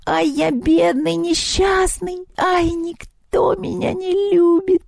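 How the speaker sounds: very high, drawn-out goblin keening; loud lamenting wail. A narrator's reading. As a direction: wail theatrically in self-pity, stretching every word. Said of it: "и заголосил".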